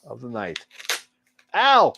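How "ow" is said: mock yelp. Mock cut on knife.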